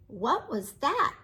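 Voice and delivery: usual speaking voice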